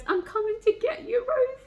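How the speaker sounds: high, urgent, near tears; rushing out to save Rosie; urgent call. Acted. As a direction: call out to her desperately. Reading Dean's dramatic line aloud.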